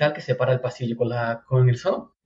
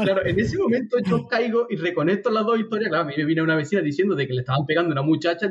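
normal talking voice

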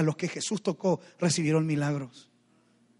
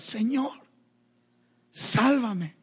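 restrained plea; 4 semitones higher, much slower than usual. Retold or imitated. Repeats Peter's two-word cry, restrained.

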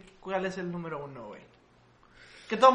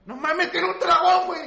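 incredulous objection; 9 semitones higher, 8 dB louder than usual. Real, not acted. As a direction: shout back in comic disbelief. Arguing back about the mascot.

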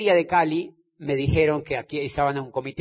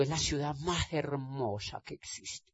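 softly, almost to himself; first answer said quietly, understated; mild soft first take; 2 semitones lower, 12 dB quieter than usual. Retold or imitated. Answering abroad about Cali, quietly. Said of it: "¿Ah?".

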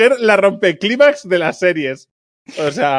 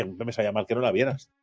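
mild soft excuse; 7 semitones lower, 12 dB quieter than usual. Retold or imitated.

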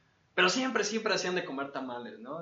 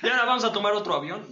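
excited announcer; 6 semitones higher, 6 dB louder, much faster than usual. Acted. Host announcing travel.

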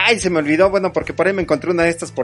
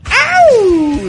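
high yowling tiger cry; tiger growl; 8 dB louder than usual. Pretend. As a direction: let out a playful tiger howl. Tiger roar for nickname.

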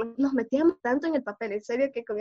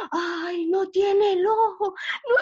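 mock horror; 7 semitones higher, slower than usual. Brothers scaring hurt sister.

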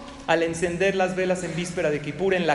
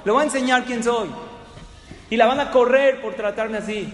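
high, loud, threatening guest rant; retold angry shouting; 6 semitones higher, 7 dB louder than usual. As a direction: storm at the receptionist, full of self-importance. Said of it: "le empezó a gritar".

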